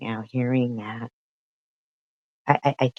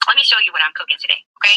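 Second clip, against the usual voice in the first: high, loud, very fast sing-song voice; mocking imitation; 8 semitones higher, 8 dB louder, much faster than usual. Streamer mimicking the wife's cooking video, high and very fast. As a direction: rattle it off high and fast like a bubbly cooking host.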